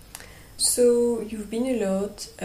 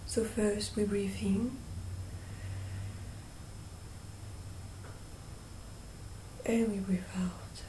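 guided meditation; 9 dB quieter than usual. Real, not acted. Guide leading listeners through breathing and calling on angels, hushed meditation voice. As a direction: gently guide them, leaving long silences between phrases.

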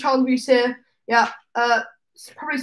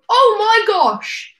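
mock delight; 8 semitones higher, 5 dB louder, much slower than usual. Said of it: "I was like".

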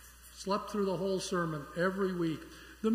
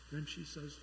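ordinary talk, nothing marked

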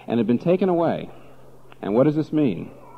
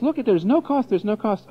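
mock relativist; 11 semitones higher than usual. Parodying relativist view of Jesus.

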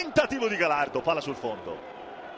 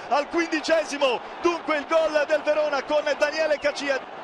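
breathless italian goal commentary; 3 semitones higher than usual. Real, not acted. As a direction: scream the goal with joy. Verona goal, shouted call.

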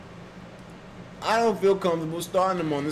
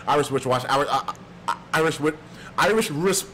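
rushed tongue twister, tripping over it; hurried, fumbled repeated attempts; racing and stumbling. Shown as a demonstration. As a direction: race through "Irish wristwatch" and keep restarting. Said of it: "I gotta say it faster".

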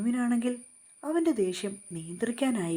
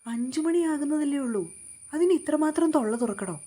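indignant retort; 6 semitones higher, 4 dB louder than usual. A narrator's reading. Ammalu snaps back at Vishnu.

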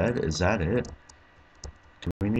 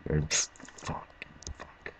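breathy whisper, almost silent; seething whisper; 3 semitones lower, 10 dB quieter, slower than usual. Real, not acted. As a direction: curse under your breath. Failing at final part.

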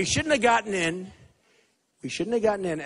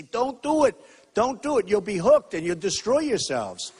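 loud, higher-pitched plea; urgent warning; 3 semitones higher, 5 dB louder than usual. Acted. Candidate acting out a talk to kids about drugs, urgent. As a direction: press the warning hard, punching each command. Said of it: "talk to the kids and say".

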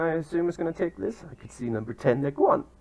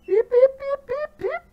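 high squeaky beep-beep noises; chirpy mock beeping, very high pitched; imitated beeping; 7 dB louder than usual. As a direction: mimic an electronic alarm, squeaky and high. Mimicking a game alert sound.

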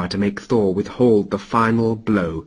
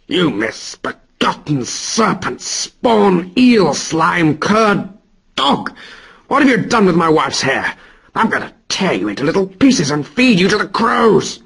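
roaring Thor; 6 semitones higher than usual. Acted. Thor roars at Loki holding him to the wall. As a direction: spit each insult at Loki.

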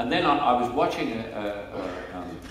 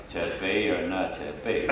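lowered, mock cowboy film-star delivery of Shakespeare; John Wayne impression; 3 semitones lower than usual. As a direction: recite Hamlet as an imitated Western star. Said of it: "So he went".

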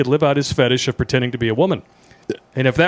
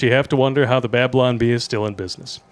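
ordinary delivery

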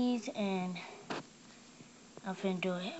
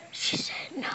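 whispering; 5 semitones higher than usual. Retold or imitated. Retelling mom's refusal, whispered.

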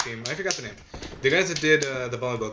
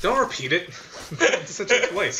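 words breaking into real laughter; laughing while talking; 6 semitones higher than usual. Real, not acted. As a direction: talk through a laughing fit. Cracking up at a joke.

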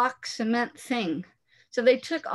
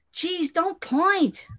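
drawn-out, higher, slow protest; embarrassed scolding; 8 semitones higher, much slower than usual. Acted. Boy tells his friend not to point, slow embarrassed protest. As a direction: stretch it out, mortified.

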